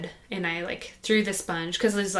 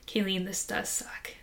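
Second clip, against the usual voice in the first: gentle whisper; 6 dB quieter than usual. Retold or imitated. Voicing God comforting her.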